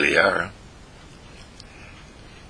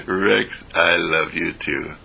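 gentle; 4 semitones lower, slower than usual. Real, not acted. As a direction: say it gently to a beloved dog. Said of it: "The gentleness in my voice".

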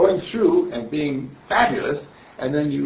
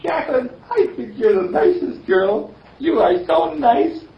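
put-on sweet little girl; 11 semitones higher, 6 dB louder than usual. Little girl compliments the bully Jacqueline, sweet and high. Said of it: "the little girl looks up at Jacqueline".

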